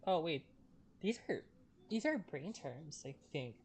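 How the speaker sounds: ordinary talk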